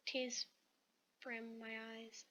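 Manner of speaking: ordinary delivery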